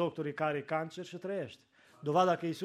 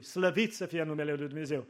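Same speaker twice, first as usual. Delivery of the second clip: usual speaking voice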